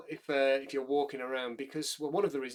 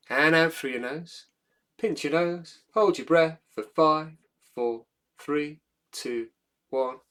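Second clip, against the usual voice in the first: guided breathing countdown; 7 dB louder than usual. Acted. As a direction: count them down steadily.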